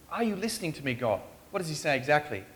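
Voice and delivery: ordinary talk, nothing marked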